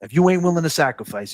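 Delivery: usual speaking voice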